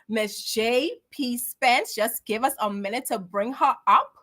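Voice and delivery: ordinary delivery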